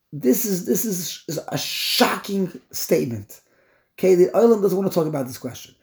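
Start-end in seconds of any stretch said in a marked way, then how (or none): none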